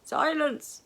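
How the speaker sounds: loud, high bark of a command; hollered. A narrator's reading. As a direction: holler at the prisoner to shut up. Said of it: "hollered the guard".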